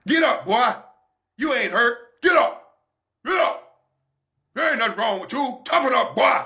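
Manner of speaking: hint of a stern father barking get up; lightly voiced parent ordering the boy up. Parent scolding a hurt boy, mildly stern.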